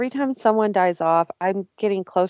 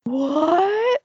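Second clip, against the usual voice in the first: shocked what; 11 semitones higher than usual. Retold or imitated.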